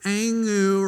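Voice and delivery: high, loud garbled "Angu" for thank you; toddler gibberish. Retold or imitated. Toddler finally thanking her mom, gibberish "Angu". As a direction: blurt "Angu" like a baby mangling thank you. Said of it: "gibberish accent".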